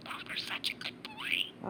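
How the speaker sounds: whispery little bird voice copying words; chirpy talking budgie; parakeet chatter. Real, not acted. Parakeet echoes owner.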